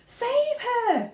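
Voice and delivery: very high, loud pleading cry; cried. Acted. Mother pleads with Wonka, desperate. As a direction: beg desperately. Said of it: "cried Missus Beauregard".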